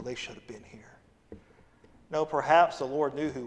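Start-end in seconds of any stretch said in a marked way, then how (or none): 0.0-0.8 hushed, breathy aside; mock whisper. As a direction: whisper it like a petty secret. Mocking a churchgoer's thought.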